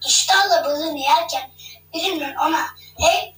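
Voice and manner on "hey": higher-pitched hailing call; a short, raised call-out. A narrator's reading. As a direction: call "Hey!" to get someone's attention. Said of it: "diye seslendiğini duydu".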